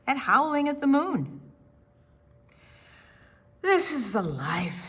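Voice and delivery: usual speaking voice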